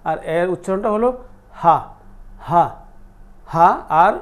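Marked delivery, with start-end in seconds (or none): 1.6-3.7 short "হা" with a plain glottal h; ha from throat's start. Shown as a demonstration. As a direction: say "হা" from the very bottom of the throat. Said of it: "শুরু থেকে, এইটাকে আমরা ধরি কণ্ঠনালীর শুরু". ("হা" কণ্ঠনালীর শুরু থেকে)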